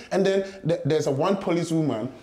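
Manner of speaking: ordinary talk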